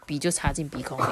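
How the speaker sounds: ordinary delivery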